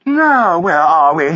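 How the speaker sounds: high, airy society woman; high-voiced lady taking charge; chirpy wife. A narrator's reading. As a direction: pipe up briskly, pitched high.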